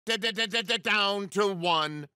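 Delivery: rapid machine-like stutter on the first syllable; glitching announcer. Acted. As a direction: skip on the opening sound, then announce. Glitching game announcer repeats syllables.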